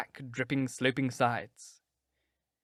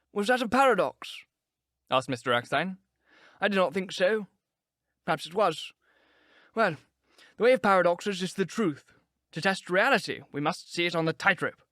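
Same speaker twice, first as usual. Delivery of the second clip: put-on higher, amused elderly scholar voice; musing old gentleman; 6 semitones higher than usual. A narrator's reading. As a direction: toy with the idea like a wry old wit. Erskine voiced, musing paradoxes.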